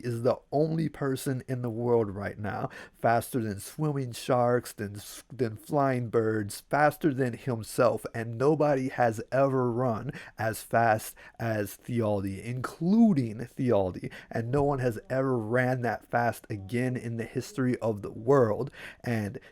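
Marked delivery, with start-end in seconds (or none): none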